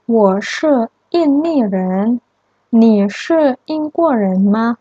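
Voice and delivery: Ali's reply, read slowly and clearly; lightly voiced Ali, slow for learners; slow dialogue line. Shown as a demonstration. Teacher reading Ali's reply to students, slow and clear. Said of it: "Ali shuo".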